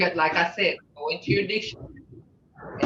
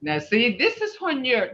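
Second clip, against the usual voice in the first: normal talking voice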